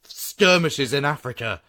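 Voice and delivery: big enthusiastic push for bigger; hyped up, slowing on each idea; excited. Real, not acted. Excited pitch for sillier sequel.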